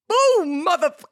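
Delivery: high, loud celebratory shout; triumphant yell. Real, not acted. Podcaster bursts out after finishing her poem, yelling. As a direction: yell it like a victory.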